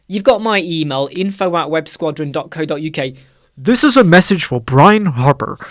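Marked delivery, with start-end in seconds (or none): 3.6-5.6 announcement. Real, not acted. Direct address to named viewer.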